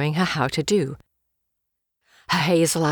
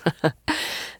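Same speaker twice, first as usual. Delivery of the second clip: brief, breath-only chuckle; soft chuckle; 4 dB quieter than usual. A narrator's reading. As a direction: chuckle lightly without voicing it. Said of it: "a laugh which belonged with his voice".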